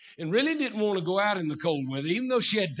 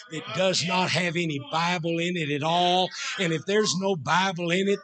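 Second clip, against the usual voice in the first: normal talking voice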